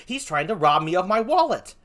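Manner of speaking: ordinary talk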